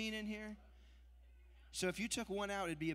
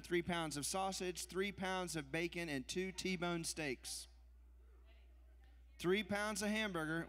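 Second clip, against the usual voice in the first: ordinary talk, nothing marked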